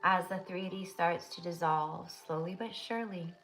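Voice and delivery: soft, steady meditation voice; guided meditation. Acted. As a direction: lead them gently into the vision.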